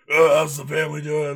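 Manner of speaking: loud, high movie soldier voice; army movie voice. Pretend. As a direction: ham it up like a war film soldier. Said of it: "break out the arm voice".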